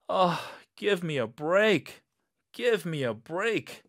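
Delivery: high, fed-up exclamation; exasperated. Acted. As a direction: throw up your hands in annoyance. Exasperated at car failing.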